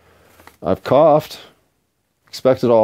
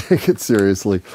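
normal talking voice

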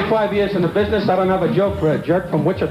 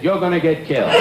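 Jack Benny impression; 5 semitones lower than usual. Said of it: "He said".

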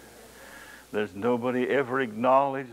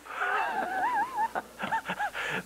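delighted laugh; 4 dB quieter than usual. Real, not acted. Laughs at Jesus' answer.

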